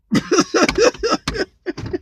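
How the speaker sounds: a long high laugh; high real laughter. Real, not acted. The speaker cracks up telling the stop-sign story, laughing. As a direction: burst out laughing.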